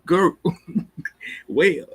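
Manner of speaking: can't hold back the laugh. Real, not acted. Laughing at the show's drama.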